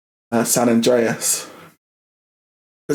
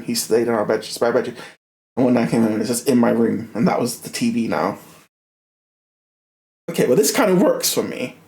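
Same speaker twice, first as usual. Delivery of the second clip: usual speaking voice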